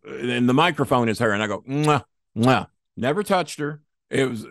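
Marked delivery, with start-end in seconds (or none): 1.6-2.7 two smacking air kisses, mwah mwah; mock air kisses. He air-kisses a woman while soaked in sweat, smacking mwahs. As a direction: blow pretend kisses past her cheek. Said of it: "air kisses".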